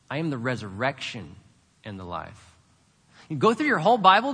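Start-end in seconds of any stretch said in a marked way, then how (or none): 0.1-2.6 weight "resurrection", pause, drop low on the rest; stress on RESURRECTION. Shown as a demonstration. Preacher showing how to meditate on a verse, stress on "resurrection". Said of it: "emphasize a different word in the sentence".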